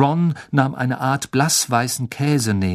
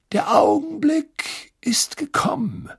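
whispered announcement; slower than usual. A narrator's reading. Dumbledore opens the tournament, hushed and slow. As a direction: let it hang in a hush.

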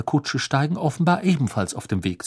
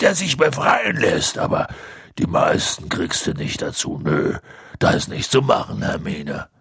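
stubborn Hagrid. A narrator's reading. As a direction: dismiss her idea like a set-in-his-ways gamekeeper. Narrator voices Hagrid.

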